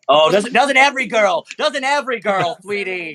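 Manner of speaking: high sassy teasing voice; sassy girl voice. Acted. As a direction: tease her up high, sassy. GM plays sassy girl character.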